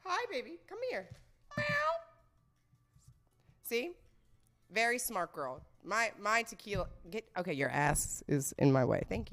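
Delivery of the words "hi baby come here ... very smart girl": high, soft cooing. Real, not acted. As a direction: coax a pet over sweetly. Coaxing her pet over.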